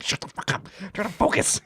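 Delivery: hissed whisper rising into a strained, high plea; irritated whisper. Retold or imitated. Hunter trying to focus as his uncle talks, irritated whisper. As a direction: tell them off without scaring the prey.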